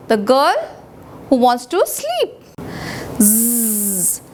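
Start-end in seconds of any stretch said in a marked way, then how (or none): 3.2-4.2 snore. Shown as a demonstration. Teacher voicing father's snore.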